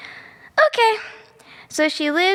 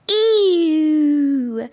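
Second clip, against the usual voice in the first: disgusted ew. Real, not acted. Prince kisses the girl.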